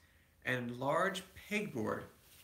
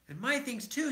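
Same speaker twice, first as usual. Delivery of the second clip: higher cub voice, complaining; cried out; 10 semitones higher than usual. A narrator's reading. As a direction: join in the protest. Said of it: "cried Brother".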